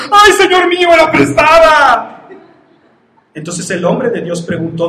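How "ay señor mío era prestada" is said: crying out. Acted. Prophet shouting to his master over the lost axe head. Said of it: "dio voces diciendo".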